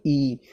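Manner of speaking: ई drawn out as a double sound; long ई. Shown as a demonstration. Teacher showing the long vowel, ई held long. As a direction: stretch the ई out. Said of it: "थोड़ा ज़्यादा लंबा खींच करके बोलना पड़ता है".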